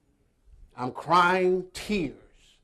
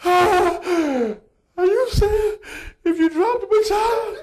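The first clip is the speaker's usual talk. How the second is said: high, loud, laughing and drawn out; the coach cracks up at the boy's fear; 6 dB louder than usual. Retold or imitated. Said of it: "Coach comes up to me".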